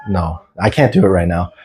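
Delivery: usual speaking voice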